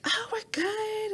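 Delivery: high, slow, breathy brush-off; mock the girls' dismissive whisper. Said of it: "they're like".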